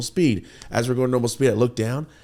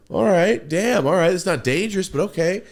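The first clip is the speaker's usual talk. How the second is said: high, loud startled reaction; half-laughing shock at the driver; startled alarm; 9 semitones higher, 6 dB louder than usual. Retold or imitated. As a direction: jolt up in alarm as the car swerves. Reacting to reckless lane changes.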